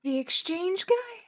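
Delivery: high, slow surprised question. Real, not acted. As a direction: ask it in disbelief. Surprised reaction.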